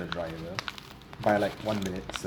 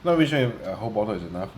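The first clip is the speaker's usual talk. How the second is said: ordinary talk, nothing marked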